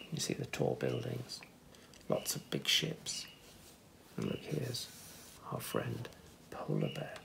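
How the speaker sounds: soft secret whisper. Real, not acted. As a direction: whisper it, pointing at the picture. Aside to listener over picture.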